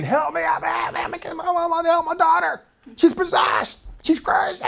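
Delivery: high, strained cries for help; a desperate mother bursting into the teaching, crying out high; frantic pleading. Acted. Mother bursting into the teaching, pleading in a high strained cry. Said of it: "just screaming".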